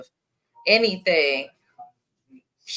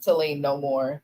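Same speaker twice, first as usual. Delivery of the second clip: ordinary talk, nothing marked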